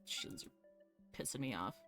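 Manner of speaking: ordinary talk, nothing marked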